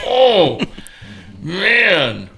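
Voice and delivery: drawn-out groan into slow, strained resolve; slow, stretched words, pulling yourself together after the blow; winded, recovering. Acted. Kicked hunter warns the farmer it's his turn, winded and slow. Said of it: "catches his breath, comes back up".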